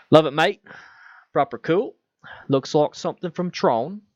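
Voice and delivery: lightly hammed-up Australian bloke voice; Aussie mate accent. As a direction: put on an Australian accent, all cheerful mate. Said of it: "I have no idea if that's your accent, but I felt like saying it that way".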